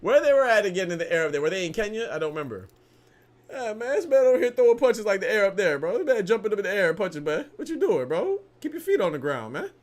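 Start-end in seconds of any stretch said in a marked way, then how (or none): 3.5-9.7 high and loud, fast incredulous ribbing; clowning on the fighter, rising to a squeal; excited mockery. Real, not acted. Mocking fighter's jumping punches.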